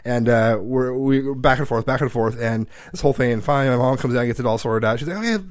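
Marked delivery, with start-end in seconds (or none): 5.2-5.4 stern mom. Retold or imitated. Mom ordering kids to talk.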